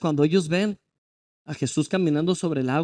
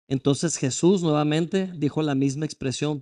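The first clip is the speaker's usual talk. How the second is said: normal talking voice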